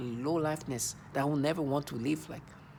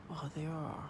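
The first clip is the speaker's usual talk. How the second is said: soft, breathy mock of their voice; imitating their hushed tone; 9 dB quieter than usual. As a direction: whisper it as they would. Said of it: "That's how they sound".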